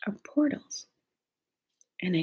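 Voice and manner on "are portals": secretive whisper. Retold or imitated. Husband leans in to tell her a secret, whispering. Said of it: "he leans in and he goes like this".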